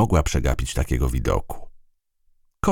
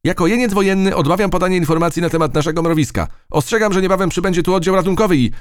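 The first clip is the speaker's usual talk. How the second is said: high, rapid military recitation; ant soldier; 4 dB louder, faster than usual. Acted. Captured ant refuses to talk, fast high soldier voice. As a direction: rattle it off like a tiny defiant soldier.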